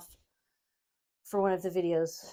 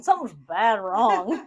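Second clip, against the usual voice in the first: slow country drawl on something's bad wrong; country accent; 6 dB louder, slower than usual. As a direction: say it like a rural Southerner. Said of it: "just in a country voice".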